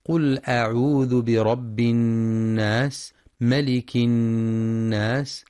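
slow melodic chant; Quran recitation. Shown as a demonstration. Teacher reciting Surat an-Nas, slow chant. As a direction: recite slowly with tajweed.

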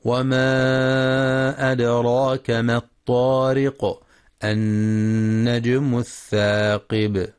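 slow, measured tajweed chant; sustained, level-toned tajweed recitation; Quran recitation. Acted. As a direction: recite with long, held vowels and nasal hums.

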